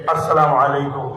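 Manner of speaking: normal talking voice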